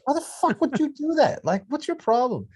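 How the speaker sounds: high-pitched indignant squawk; hammed-up, loud exasperation; mock outrage. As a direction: rant in an exaggerated high voice. Acts out annoyed Spanish speaker's reply.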